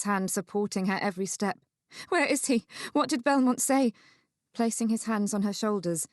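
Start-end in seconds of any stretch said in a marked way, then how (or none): 2.1-3.9 high, urgent questions; higher-pitched anxious mother. Acted. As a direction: demand news of your son. Mother urgently asks after son.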